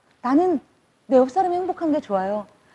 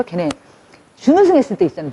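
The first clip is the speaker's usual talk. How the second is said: usual speaking voice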